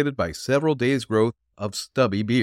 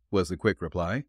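ordinary delivery

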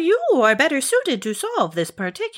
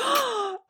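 offended gasp; 12 semitones higher, 6 dB quieter than usual. Acted.

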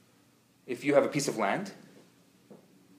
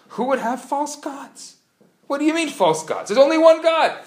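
rising, louder, disbelieving outcry; shocked angels; 10 semitones higher, 7 dB louder than usual. Retold or imitated. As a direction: angels aghast, voice climbing higher and louder. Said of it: "The angels are shocked".

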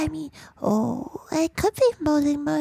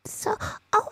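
ordinary talk

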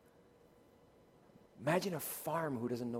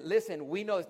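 usual speaking voice

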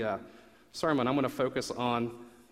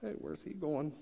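hushed, higher gossiping whisper; guests whispering; 4 semitones higher, 7 dB quieter, slower than usual. Retold or imitated. Guests at the feast whispering about the brother, hushed. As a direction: whisper it like a curious party guest. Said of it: "they're all, like, whispering".

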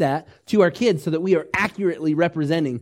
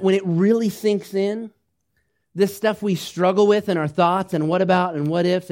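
ordinary talk

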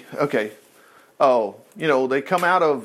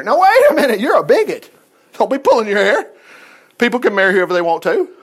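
higher and louder, hammed-up scolding; mock accusation; 3 semitones higher, 5 dB louder than usual. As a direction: play the indignant critic calling Ezra a bigot. Mocking modern critic of Ezra.

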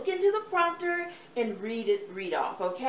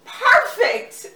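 high, loud laughing praise; delighted cry; 13 semitones higher, 12 dB louder than usual. Real, not acted. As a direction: burst out with joy. Director delighted by take.

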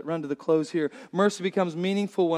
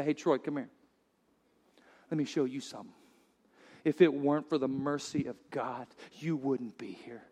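the Lord, tender; 6 semitones lower, 9 dB quieter than usual. Acted. The Lord greeting Troy.

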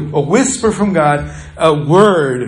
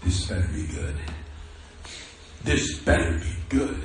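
warning look voiced; 10 dB quieter than usual. Skeptical patient staring down the preacher, hushed warning. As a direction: say it quietly, with veiled threat. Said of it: "his look was".

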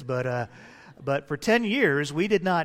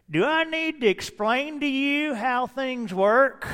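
put-on high, drawling country boy; 7 semitones higher than usual. Mock country boy explaining things to the preacher. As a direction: imitate a know-it-all country boy, pitched up. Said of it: "This was the country boy thing".

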